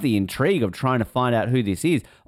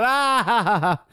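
mock laugh; 6 semitones higher, slower than usual. Showing co-hosts how to laugh about it, mock laugh. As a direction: put on a high, hammed-up laugh. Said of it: "And then laugh about it".

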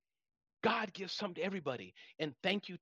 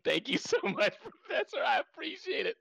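laughing; 9 semitones higher, 4 dB louder than usual. Real, not acted. Laugh heard, pitch up.

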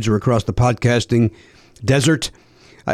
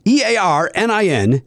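spelling it out; 6 semitones higher, slower than usual. Shown as a demonstration. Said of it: "Let me spell it for you".

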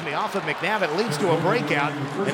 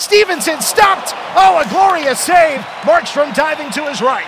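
excited save call; 8 semitones higher, 8 dB louder than usual. Real, not acted.

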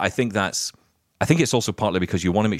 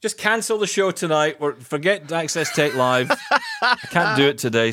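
laughing; 7 semitones higher than usual. Real, not acted. Joking after the song.

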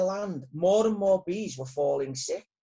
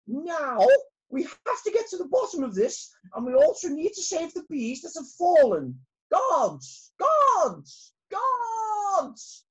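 high, loud royal command; queen calling out; 11 semitones higher, 5 dB louder, slower than usual. Acted. Queen bee calling her guards, a high loud commanding call. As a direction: summon the guards loudly. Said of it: "she shouted".

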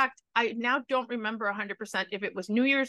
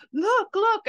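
put-on high, loud taunting kid brother; pushy brother voice; 12 semitones higher, 7 dB louder than usual. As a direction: yell it like a pestering little brother. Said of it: "my brother goes".

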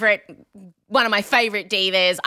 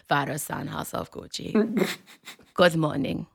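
soft, hushed Italian accent on Father, son; in character, Italian accent; 4 semitones lower, 5 dB quieter than usual. Shown as a demonstration. Host getting into character as the Italian widow, soft accent. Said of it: "I have to get into character".

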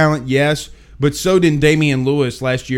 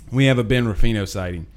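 ordinary talk, nothing marked

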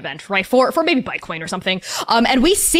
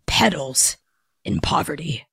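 growly gnoll voice; 5 semitones lower, 5 dB quieter than usual. Acted. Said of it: "growly voice next to him".

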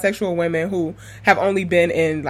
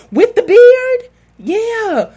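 very high, loud playful squeal; high-pitched hammed-up fan question; excited mock reaction; 6 dB louder, much slower than usual. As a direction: gush in exaggerated disbelief. Her exaggerated reaction to crush.

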